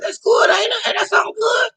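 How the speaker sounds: high squeaky mock-naive voice; naive inner voice. To herself, pleased with the car, squeaky high. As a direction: squeal it like a clueless kid. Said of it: "I was thinking to myself".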